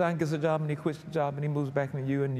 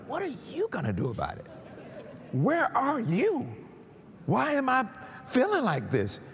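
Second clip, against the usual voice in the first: believer's retort; 8 semitones higher than usual. Retold or imitated. Troubled believer asking God where he is, protesting. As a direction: throw the question back, then climb in pitch. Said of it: "And I'm like".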